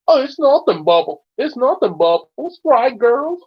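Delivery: high, falsely casual nervous brush-off; anxious "nothing's wrong" act. A narrator's reading. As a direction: brush it off nervously, pitch jumping up. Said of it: "Crystal laughed nervously and said".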